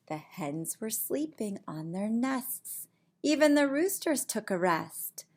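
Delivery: lively storybook voice for little ones; reading to children. Acted. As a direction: read it like bedtime story time. Reads picture book aloud.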